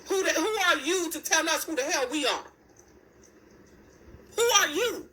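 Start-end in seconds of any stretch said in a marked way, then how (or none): 4.4-5.1 sharp high indignant challenge; indignant cry. Real, not acted. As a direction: demand it, offended and high. Angry rant at a public figure.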